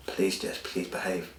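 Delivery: hushed, near-whispered begging; quiet desperate plea to his daughter. Retold or imitated. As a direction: beg her softly, almost breathless. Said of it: "I begged her".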